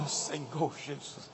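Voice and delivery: low, very soft, slow embarrassed sigh; embarrassed mumble. Acted. Caught by pastor Rafael on the way to pizza, ashamed low murmur. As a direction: mumble it ashamed, barely heard. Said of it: "eu fico super constrangido e falo".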